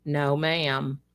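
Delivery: ordinary delivery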